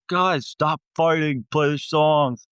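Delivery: a hammed-up plea from the crowd; mock pleading friends. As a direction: imitate friends begging the band to stop arguing. Friends pleading during band fight.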